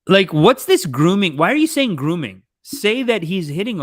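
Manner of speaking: exasperated loud challenge; loud objection. Real, not acted. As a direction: push back hard on the word. Objecting loudly to the documentary.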